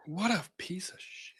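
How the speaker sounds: under-the-breath disgusted whisper. Real, not acted. Co-host reacting to the lawsuit in a whisper. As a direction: breathe it out, barely voiced. Muttered reaction to defamatory tweets.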